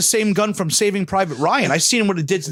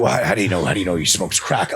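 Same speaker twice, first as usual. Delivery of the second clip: deeper, gruff dad asking how he knows; a deeper doubting dad; dad's voice; 10 semitones lower than usual. Retold or imitated. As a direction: voice the skeptical father, deeper. Dad questioning son.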